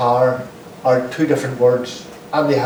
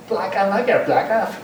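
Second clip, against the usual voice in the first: Belfast vowel, laughing; 6 semitones higher than usual. Shown as a demonstration.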